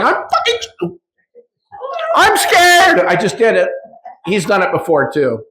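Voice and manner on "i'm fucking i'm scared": mock-terrified ego voice. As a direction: voice the ego as a frightened little creature. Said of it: "it's the ego going".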